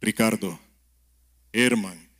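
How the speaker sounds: low, slow voice calling two names; a low, solemn call of the names; deep calling voice. Pretend. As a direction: call out like God in a dark room. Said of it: "escucha una voz grave que le llama".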